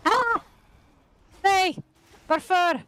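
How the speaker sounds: high, loud cries at the game; panicked outburst; alarmed yell. Real, not acted. Shouting at the boss.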